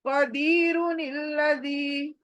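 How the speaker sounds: कदीरु निल्लज़ी in one level chanted line; joined into one. Shown as a demonstration. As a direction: recite कदीरु निल्लज़ी with no break between. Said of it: "दोनों को जोड़कर एक बनाना".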